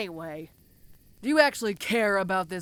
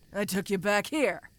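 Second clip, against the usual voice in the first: normal talking voice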